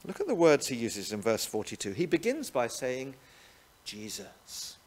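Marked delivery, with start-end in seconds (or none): 3.9-4.8 tender whispered name. Acted. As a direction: breathe the name softly. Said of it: "Isn't that lovely?".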